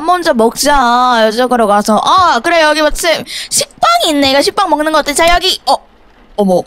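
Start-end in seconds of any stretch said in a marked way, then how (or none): none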